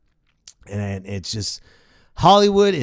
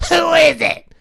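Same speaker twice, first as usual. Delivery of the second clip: high shouted mogul voice; 8 dB louder, slower than usual. Retold or imitated. Weinstein at the door.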